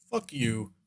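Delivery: very soft, low far-away reply; distant mimic. Mimic answering the British guy, hushed as if in the distance. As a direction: murmur it as if from far off.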